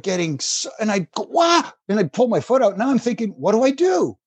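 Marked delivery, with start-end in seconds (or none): 1.3-1.7 high mock yelp of disgust; short comic cry, pitched way up; startled yelp. Wrestler retelling jerking his foot away, startled yelp. 3.3-4.2 higher, helpless retold question; mock dismay. Wrestler retelling his thinking over a wet foot, mock dismay.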